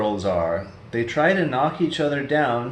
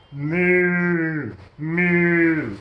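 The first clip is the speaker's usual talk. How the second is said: mooing; 4 semitones higher, 4 dB louder than usual. Acted. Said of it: "what does a cow say".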